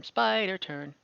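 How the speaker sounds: ordinary talk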